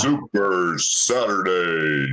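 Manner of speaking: low, drawn-out show announcer; stretched-out, deep hype-man call. Pretend. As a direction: boom it out slowly like an event promo. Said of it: "who's got the deeper voice".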